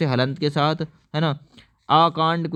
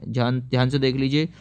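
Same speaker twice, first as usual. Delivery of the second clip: usual speaking voice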